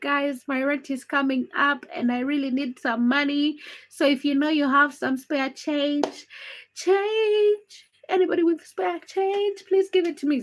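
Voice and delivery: pleading, whiny and high, stretching the words; hammed-up beg for spare change; mock begging. Mocking viewers who want her to beg for rent money, pleading. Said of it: "You want me to come here and beg".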